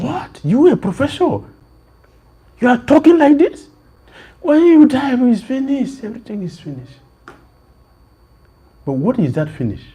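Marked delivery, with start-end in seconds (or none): 0.0-7.0 high, loud, incredulous exclamations; astonished retort. Retold or imitated. Prophet retorting to the professor in loud, high disbelief. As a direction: throw it back in disbelief, pitched high. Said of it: "Prophet said".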